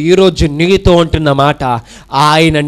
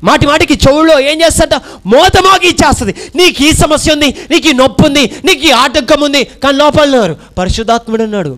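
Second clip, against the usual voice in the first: shouted, high-pitched sermon lines; full-force preaching; 9 semitones higher, 3 dB louder than usual. Acted. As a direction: preach it out with fire to the crowd. Preaching climax. (పూర్తి ఉత్సాహంతో బోధన)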